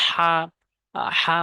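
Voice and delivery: হা pressed out from the middle of the throat. Shown as a demonstration. Teacher showing learners the letter's articulation, হা pressed from mid-throat. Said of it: "হলকের মাঝখান থেকে চাপ দিয়ে".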